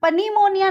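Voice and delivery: पनीमोनिया said with an initial P sounded; पनीमोनिया as puh-nee-monia; mispronunciation demo. Shown as a demonstration. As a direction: wrongly start पनीमोनिया with puh. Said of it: "नहीं बोलेंगे हम इसको".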